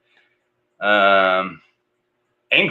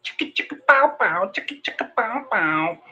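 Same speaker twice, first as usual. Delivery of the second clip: whispered "chicka" then high "bow, bow"; beatbox a wah-wah guitar; mimicking a seventies funk guitar; mock funk riff; 10 semitones higher, 6 dB quieter than usual.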